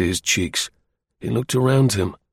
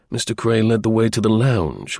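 usual speaking voice

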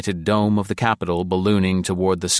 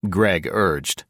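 ordinary delivery, nothing marked

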